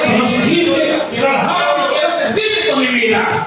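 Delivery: loud, high-pitched cry of repentance, building to a shout; fervent plea. Acted. Repentant sinner crying out to the Lord, loud and pleading. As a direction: beg the Lord, rising into a cry. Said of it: "venir como leproso y decirle".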